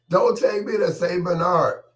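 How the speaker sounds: very high, loud mock scream. Patient repeats plea to deaf listeners, loud high hollering. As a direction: scream it out for someone who can't hear. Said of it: "I'll be hollering and screaming".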